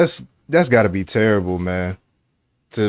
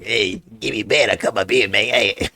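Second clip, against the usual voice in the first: lightly imitating a twitchy crackhead; a hint of a jittery addict voice. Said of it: "he even got that, that, like, kinda crackhead voice".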